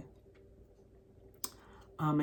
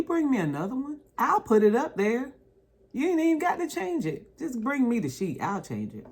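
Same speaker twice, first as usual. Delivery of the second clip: mock polite request; 7 semitones higher than usual. Acting out request to cruise staff.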